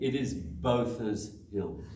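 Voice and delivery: Bothers said slowly, as "Bothers", not "Booters"; local pronunciation. Shown as a demonstration. Coach teaches runners the local name, slow and laughing. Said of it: "just so you understand completely".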